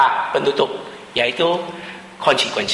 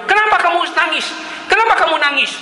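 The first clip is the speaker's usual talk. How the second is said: much higher voice, a servant gently asking why she cries; 4 dB louder, faster than usual. Retold or imitated. Servant asks her dying mistress, high and concerned. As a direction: play the servant, pitched way up and louder. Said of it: "Lalu pembantunya bilang".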